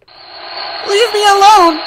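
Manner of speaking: high, loud, slow shriek; anguished scream. Acted. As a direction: scream it drawn out in terror. Horror vision scream.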